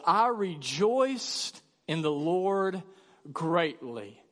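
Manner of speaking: slow, deliberate reading of the verse; slow scripture reading. Shown as a demonstration. Preacher reading Paul's words to church, slow. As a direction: lay each word down slowly.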